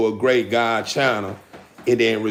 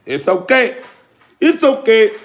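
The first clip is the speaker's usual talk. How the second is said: It's okay climbing higher and louder each time; sing-song teasing It's okay; rising mock reassurance; 8 semitones higher, 5 dB louder than usual. Real, not acted. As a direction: build it up, playful.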